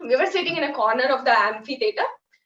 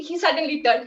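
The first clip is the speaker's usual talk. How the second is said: normal talking voice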